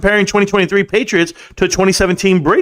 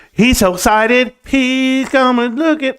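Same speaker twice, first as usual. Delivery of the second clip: slow, higher teasing voice of his wife; wife teasing about his excitement, higher and slow; mock wife voice; 6 semitones higher, slower than usual. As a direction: play the wife amused at him, drawn out. Mocking wife's reaction.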